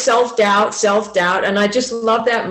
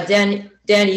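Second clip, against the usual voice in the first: ordinary delivery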